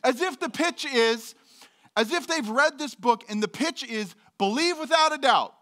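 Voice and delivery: high, loud hammed-up sales pitch; mock preacher pitch. Pastor mocking a preachy pitch, high and hammed-up.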